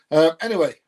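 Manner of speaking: loud, high reset; loud anyway. Real, not acted. Back to his viewers after the accent question, loud. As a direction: drag it back to the point.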